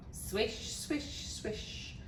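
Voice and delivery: soft, unvoiced swishes; swishing nets. A narrator's reading. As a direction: whisper the swoosh of sweeping nets. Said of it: "went the royal nets".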